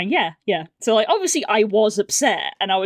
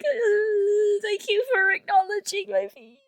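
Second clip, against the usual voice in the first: very high, squeaky crying voice; mock tearful thanks; 13 semitones higher than usual. Wife thanking husband for validating feelings, mock-sobbing. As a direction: wail it as if overcome, hammed-up.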